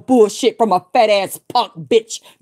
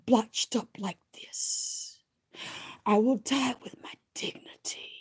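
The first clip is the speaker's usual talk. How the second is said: softer, a breathless whisper; whispered prayer; 15 dB quieter than usual. Retold or imitated. Retelling her prayer to the ancestors, an intense whisper. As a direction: hush it like a secret vow. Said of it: "I said".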